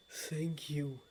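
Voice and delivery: low, soft, solemn regret; a low, hushed apology; grave lord of dreams. Acted. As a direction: speak like a deep and remorseful old god.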